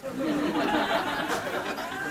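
soft amused laugh; quiet real laughter. Real, not acted. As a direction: chuckle at your own line. Laughs at own confessional line.

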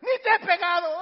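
loud, high exasperated yell; parent's scolding shout. Retold or imitated. Parent to child who starts crying, exasperated shout.

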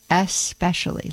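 "especially" split as "ess, pecially"; correct "especially", no X. Shown as a demonstration. Host asking listeners to join in, "especially" with a clear S. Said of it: "should all say that together".

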